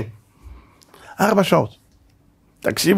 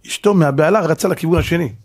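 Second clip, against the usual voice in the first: ordinary delivery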